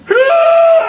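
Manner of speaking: full hammed-up growl; guttural growl. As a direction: bellow it out like a crazy man. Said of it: "like this guttural growl".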